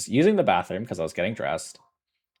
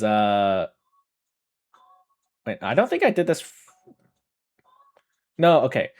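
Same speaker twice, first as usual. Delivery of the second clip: ordinary delivery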